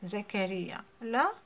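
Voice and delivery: ordinary delivery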